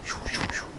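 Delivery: quiet voiceless swishes; short whooshes like a staff being swung; imitated swishing. Shown as a demonstration. Imitates swinging the staff while explaining.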